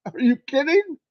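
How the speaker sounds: a touch of playful disbelief; incredulous. Real, not acted. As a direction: say it like it's absurd. Incredulous aside about food.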